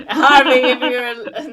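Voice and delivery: shout-out to Harvey, laughing; laughing call. Real, not acted. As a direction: call out to him through laughter. Calling to actor, laughing.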